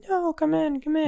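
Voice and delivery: imitating a nervous hostess; awkward high-pitched plea. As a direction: squeak out an awkward welcome. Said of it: "she also very awkwardly is like".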